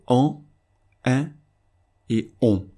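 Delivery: "en", "un", "on" each said alone as a nasal vowel; French nasal vowels. Shown as a demonstration. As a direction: separate the three nasal sounds "en", "un", "on". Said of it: "le son est proche du son français".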